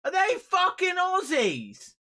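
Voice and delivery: hammed-up Australian accent yell; mock Aussie. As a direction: stretch it out in a put-on Aussie voice. Repeats question, slower, drawn out.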